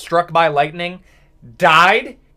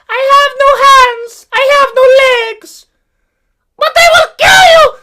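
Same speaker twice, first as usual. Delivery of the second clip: frantic squeaky creature; 8 dB louder than usual. Acted. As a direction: squeal it out like a terrified tiny creature. Acting out a frantic character.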